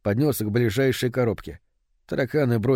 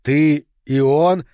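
loud, slow disbelief; incredulous question; 3 semitones higher, 7 dB louder, much slower than usual. A narrator's reading. Man asking about mother and son, incredulous question. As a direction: drag the words out, aghast. Man grasps the affair, appalled.

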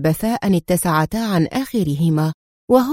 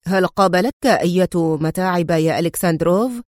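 plain reading voice

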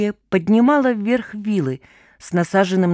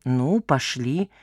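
slow, lower and shy; embarrassed; 6 semitones lower, much slower than usual. Acted. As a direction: say it slowly, a bit embarrassed. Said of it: "смущённо поводя плечом".

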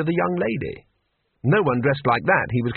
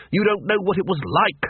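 ordinary delivery

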